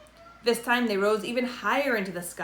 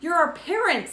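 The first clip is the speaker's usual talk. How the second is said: high, louder burst; excited reveal; 9 semitones higher, 4 dB louder than usual. A narrator's reading. Tip telling Father Len the truth, excited. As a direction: blurt it out with joy.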